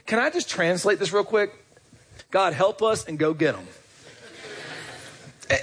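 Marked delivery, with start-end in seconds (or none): none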